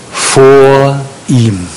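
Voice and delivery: "vor ihm" drawn out slowly, each word set apart; emphatic key phrase. Real, not acted. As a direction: weigh "vor" and "ihm" separately, lingering on each. Singles out a key little phrase.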